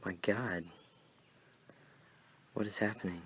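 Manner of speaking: hushed whisper of disbelief; awed whisper. Acted. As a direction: breathe it out in shock. Awed at the scene.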